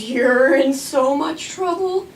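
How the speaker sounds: a mother teasing, slowly; slow mock-stern mom voice; slow ominous warning. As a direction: draw it out slowly, like a mock threat. Mom looks her up and down.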